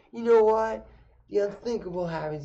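high and loud, hammed-up triumph; mock-grand reveal by the girlfriend's father; dramatic announcement. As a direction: announce it like a game show twist. Dad's dramatic reveal at dinner.

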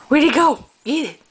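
high, eager playing with a kitten; excited pet talk. Real, not acted. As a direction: egg the kitten on, bright and loud.

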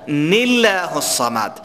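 نله الصمد with a light thin lam after kasra, slow; slow demo of light lam. Shown as a demonstration. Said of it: "اسم الله را پر بخوانیم یا باریک".

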